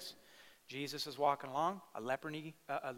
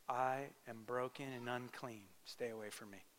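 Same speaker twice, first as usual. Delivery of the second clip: broken outcast; 3 semitones lower, 7 dB quieter than usual. Retold or imitated. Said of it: "His body language said".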